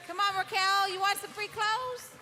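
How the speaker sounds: high, loud calls to the crowd; calling out. Real, not acted. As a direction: summon a missing winner. Calling for absent winner.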